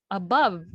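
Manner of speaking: "above" with a weak a and strong BOVE; second-syllable stress. Shown as a demonstration. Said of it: "second syllable".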